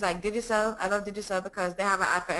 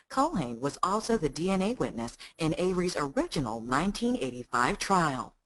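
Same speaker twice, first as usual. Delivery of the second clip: mature American voice; 2 semitones lower than usual. Acted. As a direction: settle into an older, serious American documentary read. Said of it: "a more mature voice in American accent".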